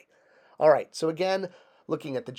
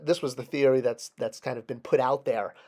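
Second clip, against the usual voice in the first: ordinary delivery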